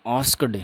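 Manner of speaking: आस्क्ड as two syllables, "as-kad"; common mispronunciation. Shown as a demonstration. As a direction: end आस्क्ड wrongly in a voiced d after a vowel. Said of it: "आस्क्ड नहीं". (आस्क्ड का गलत उच्चारण "आस्कड")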